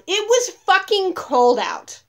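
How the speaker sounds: loud, high, slowed-down outburst; exasperated shout. Real, not acted. Telling viewers the open jackets were deliberate, exasperated shout. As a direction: punch every word at full volume. Indignant exclamation mid-story.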